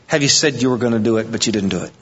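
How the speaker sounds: ordinary talk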